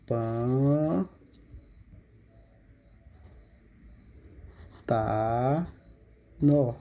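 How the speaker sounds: "Pan-, ta-, no." drawn out with long pauses between syllables; split into syllables. Shown as a demonstration. Teacher shows pupils how to separate the word pântano, syllable by syllable. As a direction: break "Pan-, ta-, no." into three separate, slow syllables. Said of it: "você separa".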